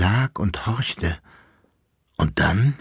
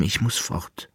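fading quiet; 7 dB quieter than usual. Acted. Rose leise, verblassend.

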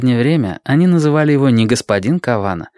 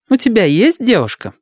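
curious nurse; 12 semitones higher than usual. Acted. Tamura quizzing Tengo.

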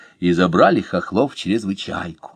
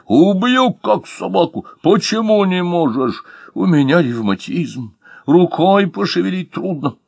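hammed-up robber threat; 8 semitones higher than usual. Robber threatens, gentleman pleads.

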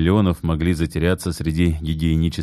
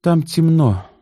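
tired; 11 semitones higher, 4 dB louder, slower than usual. A narrator's reading. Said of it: "устало сказала Таисия".

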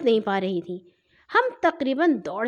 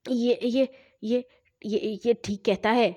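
stammering; 2 semitones lower than usual. A narrator's reading. Frightened mother stammering to her children. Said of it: "وہ ہکلائیں".

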